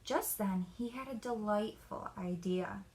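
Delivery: ordinary delivery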